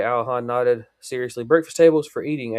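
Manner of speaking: ordinary delivery, nothing marked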